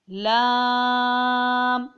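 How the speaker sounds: لام held very long, steady and loud; five-alif stretch. Shown as a demonstration. Teacher demonstrating the length, long held لام. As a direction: draw out the vowel of لام evenly. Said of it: "پانچ الف کی مقدار کھینچ کے".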